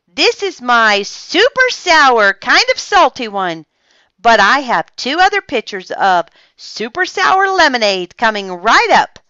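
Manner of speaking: emphatic, upbeat seller's assurance; reassuring customers. A narrator's reading. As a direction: loudly win the customers back, pitched high. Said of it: "Olivia assured her customers".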